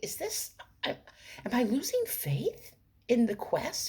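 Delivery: hushed high whisper to herself; inner thought whispered. Retold or imitated. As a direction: whisper the doubt as if thinking aloud. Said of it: "Thinking to myself".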